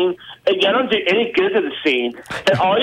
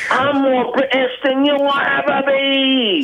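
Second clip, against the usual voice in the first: slow, mock-proud British boast; fake British accent; 3 semitones higher, slower than usual. Caller taunting the host in a fake British accent. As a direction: boast like a cartoon Englishman. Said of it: "He only has one accent".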